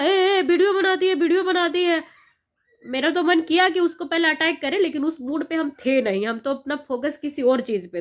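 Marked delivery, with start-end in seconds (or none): 0.0-2.0 raised, jeering voice, higher and louder; mocking a heckler. Heckler taunting her at the police station, raised jeering voice. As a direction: sneer it like a rude onlooker. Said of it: "वो भी बोलने लगा".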